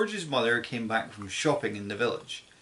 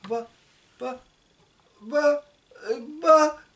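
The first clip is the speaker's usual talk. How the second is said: higher, flustered stammer; mother stunned by a giant hen; 13 semitones higher than usual. A narrator's reading. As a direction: trip over each but in shock. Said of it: "stammered George's mother".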